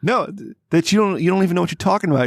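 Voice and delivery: high, fast mocking scold; mocking a friend. Retelling what she said, high and fast mock scold. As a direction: quote her lecture in a high mock voice. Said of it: "And then she said".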